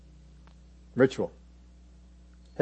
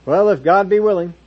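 rote catchphrase; 4 semitones higher, 7 dB louder than usual. Acted. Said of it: "catchphrases like this".

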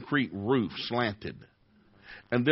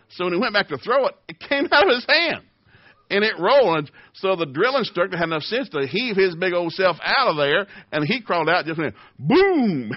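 excited rising storytelling; 6 semitones higher, 6 dB louder than usual. Retold or imitated. Preacher telling the congregation about the dropped grenade, excitedly loud. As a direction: voice climbing higher and louder as the grenade slips.

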